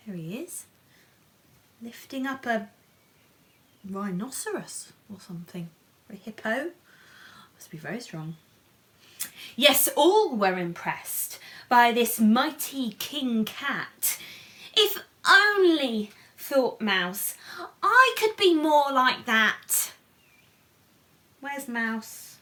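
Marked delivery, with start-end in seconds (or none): 3.9-8.4 whisper. Real, not acted. 9.6-19.9 higher, louder lively read-aloud; storybook narration. A narrator's reading. Reading the picture book aloud to children, animated.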